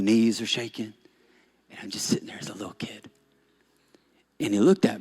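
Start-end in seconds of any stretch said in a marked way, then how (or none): 1.7-3.1 a hushed, fast whisper; small scared whisper. Retold or imitated. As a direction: whisper like a frightened child. Scared child waiting.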